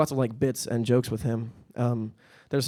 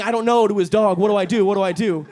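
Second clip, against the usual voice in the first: high, louder mock panic; 6 semitones higher, 4 dB louder than usual. Naked guy frets to his dog, high mock panic. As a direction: fret helplessly to the dog.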